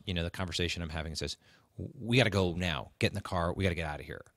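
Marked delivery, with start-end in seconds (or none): none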